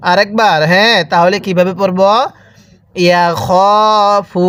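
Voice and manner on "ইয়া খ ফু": "ইয়া খ ফু" with a long held খ; drawn-out vowel. Shown as a demonstration. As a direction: stretch the খ in "ইয়া খ ফু". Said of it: "এরকমভাবে শিখব".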